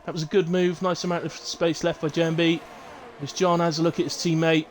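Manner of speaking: excited play-by-play. Real, not acted. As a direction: call the overtake live. Live race commentary.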